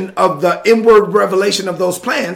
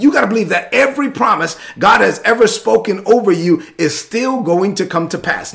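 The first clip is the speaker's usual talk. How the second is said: ordinary talk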